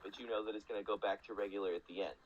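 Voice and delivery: ordinary delivery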